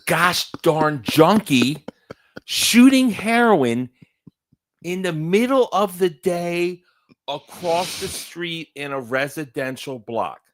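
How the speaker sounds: loud, high, drawn-out mock outrage; retold astonishment. Co-host retelling a junkie sighting to Jack, incredulous outcry. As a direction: slowly belt out each phrase in disbelief.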